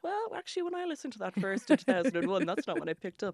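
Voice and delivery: high, loud pretentious critic send-up; mock music critic. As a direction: play at being an opinionated music critic. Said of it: "turn into a bunch of music critics".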